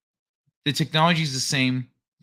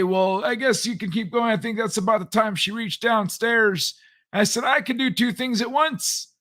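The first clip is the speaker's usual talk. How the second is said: put-on much higher, cranky old geezer voice; grumpy old-timer boasting, pitched way up; old man voice; 9 semitones higher than usual. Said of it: "I almost broke into my old man voice".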